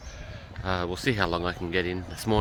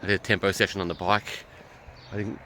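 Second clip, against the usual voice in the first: usual speaking voice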